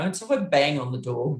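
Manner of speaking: ordinary delivery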